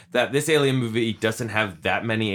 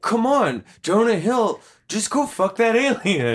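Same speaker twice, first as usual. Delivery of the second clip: high-pitched, pleading mock pep talk; hammed-up exasperated coaxing; playful urging; 8 semitones higher than usual. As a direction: egg a reluctant buddy on, pitched up. Acted urging of Jonah Hill.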